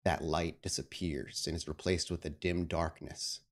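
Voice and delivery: usual speaking voice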